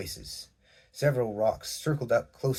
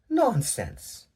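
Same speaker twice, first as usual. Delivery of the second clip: Crystal, dismissive; 13 semitones higher, 4 dB louder than usual. A narrator's reading. Crystal brushing past.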